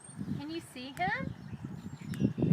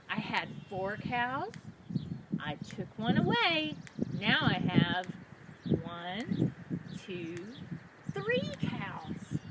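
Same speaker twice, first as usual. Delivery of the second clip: normal talking voice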